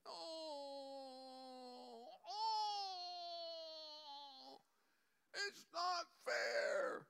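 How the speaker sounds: high, slow, crying whine; a self-pitying sinner whining, slow and tearful. Acted. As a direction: wail it like a sulking child.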